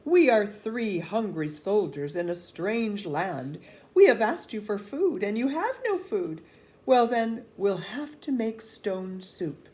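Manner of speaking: loud announcement to the crowd; proclaiming soldier. A narrator's reading. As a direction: speak up so the whole square hears.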